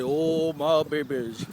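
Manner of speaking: low, drawn-out grandpa voice; deep-voiced grandfather. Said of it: "he had this real deep voice".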